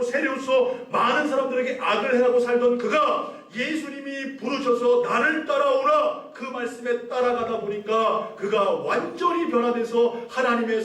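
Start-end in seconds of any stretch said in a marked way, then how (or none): 5.0-6.2 high, slow, solemn call; a commanding call to follow; voice of Jesus. Acted. Jesus calls the tax collector, high and slow.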